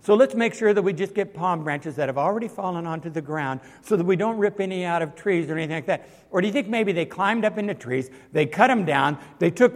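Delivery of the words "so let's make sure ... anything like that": mock considerate crowd. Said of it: "they looked around and said".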